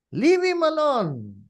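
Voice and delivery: slow, high, loud call; defensive plea. Acted. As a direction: draw it out loudly, standing up for him. Imagined bystander defends Jesus.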